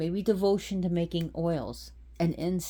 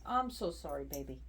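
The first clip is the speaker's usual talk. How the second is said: softer, tender words to a pet; tender apology; 2 semitones lower, 8 dB quieter than usual. Real, not acted. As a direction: soothe the cat gently. Apologizing softly to the cat.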